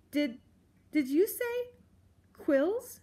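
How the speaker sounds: high, halting scared voice; stammering fear. A narrator's reading. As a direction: stumble over it in terror. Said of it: "she stammered".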